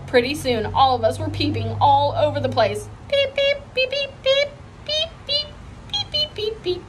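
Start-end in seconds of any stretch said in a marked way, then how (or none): 3.1-4.5 very high quick peeps; peeping chorus. A narrator's reading. The girls peeping all over the room, high and giddy. 4.8-6.8 very high, softer beeps; beeping. A narrator's reading. Girls turning peeps into soft high beeps. All peeping over the place.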